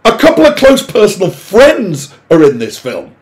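usual speaking voice

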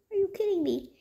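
ordinary delivery

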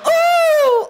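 high, loud, drawn-out "ooh"; demonstration scream. Shown as a demonstration. Comic coaches the audience to scream, high squeal. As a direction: squeal it high to show the crowd. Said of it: "I need you guys to scream".

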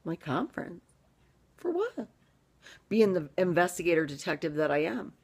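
usual speaking voice

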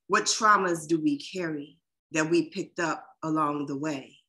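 measured, unhurried poem reading; spoken-word poem delivery; poetry reading. Acted. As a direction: read each line as its own weight, with pauses. Reads her own poem.

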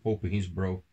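plain reading voice

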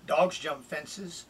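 usual speaking voice

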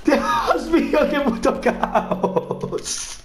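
high Dios mío fading into a breathy laugh; shocked then laughing at the chaos; stunned surprise. Real, not acted. Gamer stunned by in-game chaos, high surprise into laughter.

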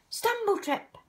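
usual speaking voice

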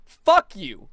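loud furious outburst; angry shout. Real, not acted. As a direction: yell it at the company. Cursing out the internet company.

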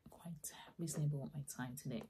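ordinary delivery

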